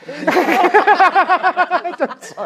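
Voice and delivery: squeaky laugh through the words; laughing apology. Real, not acted. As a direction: apologize mid-giggle.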